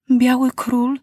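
put-on a devoted girl swearing loyalty; Klu-Klu voice. A narrator's reading. Klu-Klu speaks to the king.

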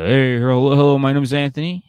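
hammed-up hearty greeting; mock loud greeting. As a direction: boom out a fake-friendly hello. Voicing Anthony's loud greeting.

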